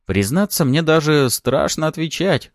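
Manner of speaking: mildly amused, self-deprecating reply; wry chuckling. A narrator's reading. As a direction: confess it with a touch of amusement. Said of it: "усмехнулся я".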